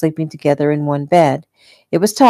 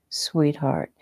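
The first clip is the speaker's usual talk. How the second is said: soft, hushed endearment; softly spoken; 8 dB quieter than usual. A narrator's reading. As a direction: drop the volume tenderly. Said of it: "he said softly".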